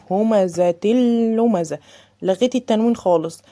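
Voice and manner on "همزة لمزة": همزة لمزة slow, tanween swallowed into the lam; slow tajweed chanting; correct recitation. Shown as a demonstration. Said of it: "ما اديتش تنوين".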